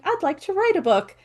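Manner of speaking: a hint of an eager little voice; writer's voice. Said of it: "the intuitive writer going".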